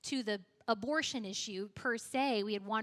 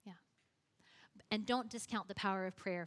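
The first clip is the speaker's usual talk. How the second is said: normal talking voice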